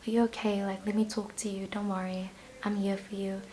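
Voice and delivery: softening to a near whisper; comforting mom. Acted. Imagined mom reassuring someone upset, soothing and hushed. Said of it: "it's like a mom, you know, and it's very comforting".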